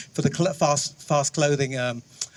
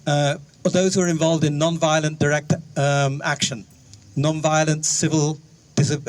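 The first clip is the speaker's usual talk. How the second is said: ordinary talk, nothing marked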